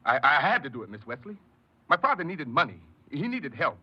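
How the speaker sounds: high, loud, pleading excuse; cornered man pleading for his father; desperate pleading. Acted. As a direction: beg her to understand, voice climbing. Pleading with boss.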